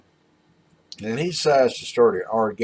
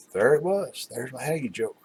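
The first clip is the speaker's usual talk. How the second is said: ordinary talk, nothing marked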